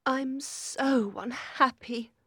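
unhappy. A narrator's reading. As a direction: confide her misery to Nelly. Cathy sadly confides to Nelly.

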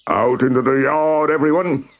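high-pitched marching orders; bossy cockerel. A narrator's reading. Cockerel sends the hens on another march, bossy.

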